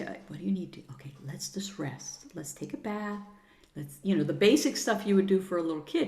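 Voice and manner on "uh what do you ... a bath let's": soothing. Retold or imitated. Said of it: "And then you say".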